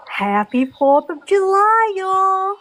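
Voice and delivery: American accent, lifting high on y'all; best American accent. Shown as a demonstration. Pony performs on request for the panel, American accent. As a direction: do a Southern-tinged American holiday greeting. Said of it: "in your best American voice".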